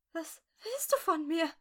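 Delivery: high-pitched, pleading whimper; frightened whimper. A narrator's reading. Woman held at gunpoint pleading with captor, whimpering. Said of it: "wimmerte sie".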